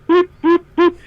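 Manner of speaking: high, short, evenly spaced boops; imitated boops. Fan glimpsing Tommy eating, high comic boops. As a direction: pop out little high beeping noises.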